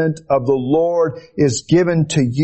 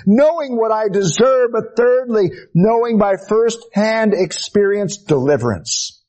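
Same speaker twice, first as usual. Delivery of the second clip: high, ringing sermon delivery; fervent preaching; 8 semitones higher than usual. Acted. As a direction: preach it urgently, pitched well up. Preaches at full pitch.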